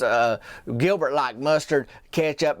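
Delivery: ordinary delivery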